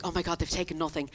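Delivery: low, soft, understated relief; first relieved reaction. Retold or imitated. Finding the burgled room intact, in low, soft relief. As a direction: say it low and softly, mildly amazed. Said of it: "Now, at first, I was like".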